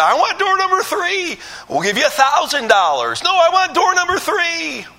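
squeaky stubborn contestant; insistent contestant. Retold or imitated. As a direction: demand door three in a high pushy voice.

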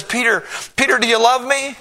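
high, loud asking; Jesus pleading with Peter, high and loud; urgent question. Acted. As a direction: call his name urgently. Jesus asks again.

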